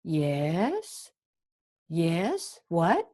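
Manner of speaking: drawn-out, questioning rise on each word; puzzled prompting, words hung in the air; acted expectant listener. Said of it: "And they go".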